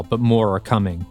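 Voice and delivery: ordinary delivery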